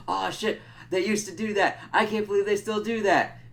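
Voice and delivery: high-pitched mock astonishment; imitated exclamation. Vampire reacting to a beheading, mock astonished. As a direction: exclaim high, acting out his disbelief. Retelling Nandor's reaction to violence.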